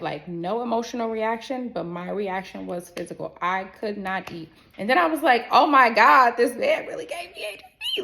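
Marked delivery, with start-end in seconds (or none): 5.5-8.0 hammed-up cry, shooting up high; mock distraught wail. Woman reliving her realization about this man, mock distraught wail. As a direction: start loud, then squeal it like a tearful wail.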